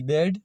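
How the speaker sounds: "बेड" with the A sounded as ए; short A demo. Shown as a demonstration. Teacher reading out a spelled example, clearly sounding ए in "बेड". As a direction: model "बेड" clearly after spelling it, vowel as ए. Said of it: "ए का उच्चारण".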